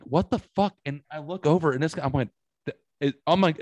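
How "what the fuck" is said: higher, louder startled what the fuck; sudden shocked exclamation, pitched up; startled outburst. Retold or imitated. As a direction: blurt it out, caught off guard. Reacting to the stranger's popcorn.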